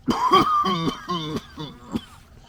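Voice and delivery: long, loud hacking cough; coughing fit. Real, not acted. Vlogger trying to get over it, coughing hard. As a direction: cough it out, high and wheezing. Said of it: "this cough".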